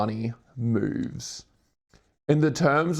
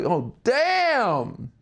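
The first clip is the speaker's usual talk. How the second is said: long, high drawn-out exclamation; big, hammy high-pitched wow; mock amazement; 9 semitones higher than usual. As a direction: play up being blown away. Mock amazed reaction to puzzle.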